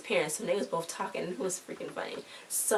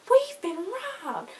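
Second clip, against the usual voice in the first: We've been robbed wailed very high, louder and slow; hammed-up panic; 4 dB louder, slower than usual. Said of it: "I gotta make it believable".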